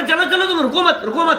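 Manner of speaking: urging companions on. Acted. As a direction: act out calling to companions to keep going. Acting out companions on a hill climb.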